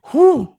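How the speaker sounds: sharp loud breath out before speaking; loud exhale. Real, not acted. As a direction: blow the air out hard and loud. Exhale before repeating anger.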